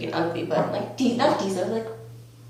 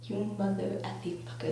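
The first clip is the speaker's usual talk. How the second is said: toned-down retold curse; 8 dB quieter than usual. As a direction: curse under your breath at a friend. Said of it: "I didn't really say that".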